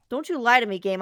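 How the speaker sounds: loud mock scolding of the game. Real, not acted. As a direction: tell the game off, half joking.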